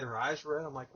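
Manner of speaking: normal talking voice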